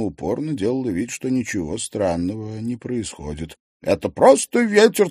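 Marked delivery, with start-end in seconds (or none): 3.8-5.1 high, loud, insistent denial. A narrator's reading. Maryana pretends nothing strange is happening, insistent. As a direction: insist firmly, pitched well up. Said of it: "твердила она".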